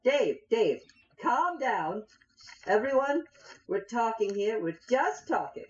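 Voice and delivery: high, pleading halfling. Acted. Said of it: "the little halfling says".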